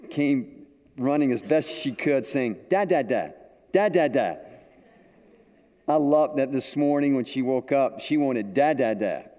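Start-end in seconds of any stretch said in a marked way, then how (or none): 2.7-4.4 put-on small child's repeated call; a light toddler voice calling for Dad; lightly voiced toddler calling Dad; imitating a toddler. 8.5-9.3 a hint of a small child calling; imitating a toddler.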